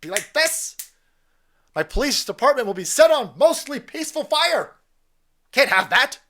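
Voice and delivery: high, loud preacher parody; mock Al Sharpton. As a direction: thunder like a rally preacher. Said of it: "Al Sharpton will beat it down here like this".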